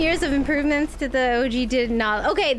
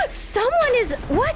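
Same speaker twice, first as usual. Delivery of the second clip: high, gasping disbelief; gasp, then blurt it out high; shocked; 14 semitones higher, 3 dB louder than usual. Real, not acted. Gasps at surprise attack in game.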